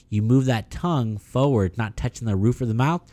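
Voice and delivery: normal talking voice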